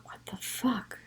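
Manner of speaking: hushed, breathy whisper to herself; whispered thought. Retold or imitated. Whispering in shock as the pain keeps building. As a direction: barely voice the curse under her breath.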